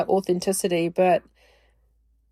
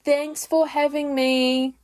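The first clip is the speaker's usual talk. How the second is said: put-on high, slow, drawn-out little girl thank-you; a child dutifully thanking her friend's mum, in a high, slow sing-song; sing-song child politeness; 6 semitones higher, slower than usual. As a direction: chirp it like a drilled child reciting manners. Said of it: "I would do it in that tone".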